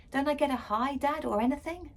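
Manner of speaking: a hint of a put-out father; dad's sarcastic complaint. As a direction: needle her for no greeting. Dad voiced mocking.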